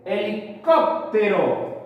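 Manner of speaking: Helicóptero slowed, CÓP set apart and leaned on; stress on CÓP. Shown as a demonstration. Teacher showing the strongest syllable, Helicóptero stressed on CÓP. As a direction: sound out Helicóptero, landing firmly on CÓP. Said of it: "La mayor fuerza de voz está en cop".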